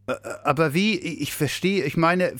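high, stammering bewilderment; utterly confused. A narrator's reading. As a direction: stumble over the words, at a loss. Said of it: "war völlig verwirrt". (völlig verwirrt, stammelnd)

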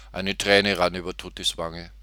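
usual speaking voice

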